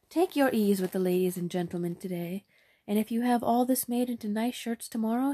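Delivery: hammed-up doting queen; delighted queen. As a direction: gush with royal approval. Queen praises and promises marriage.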